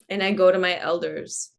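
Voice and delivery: ordinary talk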